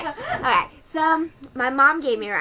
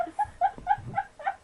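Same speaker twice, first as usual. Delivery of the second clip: burst of laughter; 7 dB quieter than usual. Real, not acted. Breaks off mid-story laughing.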